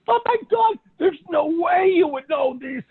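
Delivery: high, loud, tearful-sounding amazement; astonished client. Retold or imitated. Retelling the client's disbelief, high and teary. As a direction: gasp it out as if overwhelmed. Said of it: "She says".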